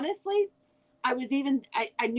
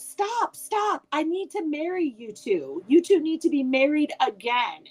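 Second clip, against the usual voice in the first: imitated excited granddaughter; 6 semitones higher than usual. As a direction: pipe up urgently like a child halting a dance. Granddaughter interrupts grandparents' dance.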